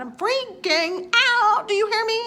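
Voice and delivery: "I'm freaking out" yelled high and stretched; panicked shout. Real, not acted. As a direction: cry it out like you're losing it. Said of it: "freaking out with a capital F".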